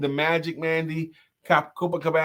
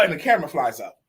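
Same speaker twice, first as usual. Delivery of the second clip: normal talking voice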